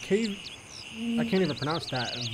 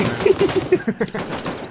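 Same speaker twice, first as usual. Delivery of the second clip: scream into giggles; 6 dB louder than usual. Real, not acted. Laughing at the AI gunfight.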